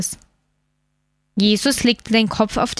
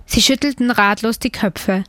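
ordinary delivery

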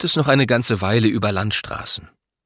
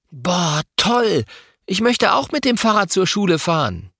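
put-on raving boy; 9 semitones higher than usual. A narrator's reading. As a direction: gush over it like a thrilled kid. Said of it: "Louis schwärmt". (begeistert schwärmender Junge)

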